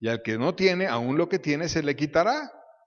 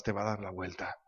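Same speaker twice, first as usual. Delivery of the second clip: ominous hush; 9 semitones lower, 12 dB quieter than usual. Real, not acted. Dropped ominous close.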